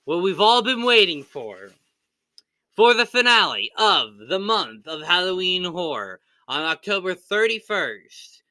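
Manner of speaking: loud, high, dramatic announcement; grand announcer build-up. Acted. Host presenting the Halloween horror finale to viewers, grand announcer build-up. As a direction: announce it with big swooping emphasis.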